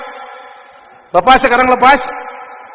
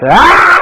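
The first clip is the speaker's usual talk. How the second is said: loud exclamation; 3 semitones higher, 7 dB louder than usual. Acted.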